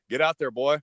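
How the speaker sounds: a hint of a commanding Texas grandfather; lightly voiced stern grandpa. Grandpa sends scared grandson out to fight, imitated firm command.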